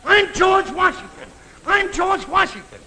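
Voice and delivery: high, loud crazed proclamation; yelling madman. Retold or imitated. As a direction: imitate a nut bellowing down the bus aisle. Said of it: "he's yelling".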